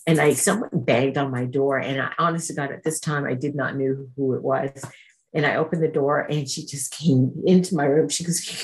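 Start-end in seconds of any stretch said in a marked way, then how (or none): none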